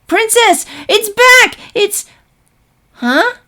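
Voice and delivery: high loud frightened call fading to puzzled; startled alarm. Acted. Filly warns her teacher the monster is back, high alarmed call. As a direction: cry a warning then stop confused.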